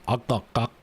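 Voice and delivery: "Ak, ak, ak" as hard glottal stops; glottal imitation. Shown as a demonstration. Imitating Vietnamese glottal sounds for a friend. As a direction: clip each "Ak" off sharply in the throat. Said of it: "like, glottal sounds".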